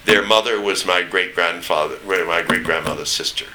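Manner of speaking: ordinary talk, nothing marked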